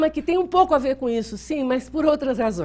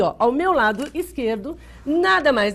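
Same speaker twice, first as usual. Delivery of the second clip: normal talking voice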